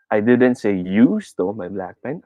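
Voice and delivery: slow line, "you" swooping up; stress on YOU. Shown as a demonstration. Teacher implying someone else was accused, stress on "you". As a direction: punch and stretch "you". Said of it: "I put my emphasis on you".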